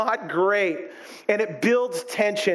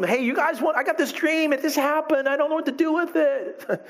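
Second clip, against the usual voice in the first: put-on higher, innocent eager kid voice; naive young Joseph; 6 semitones higher than usual. Joseph eagerly telling his brothers the dream, innocent and clueless. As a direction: play it wide-eyed and clueless.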